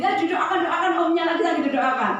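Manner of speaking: put-on high, loud child insisting. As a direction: kid eager to pray.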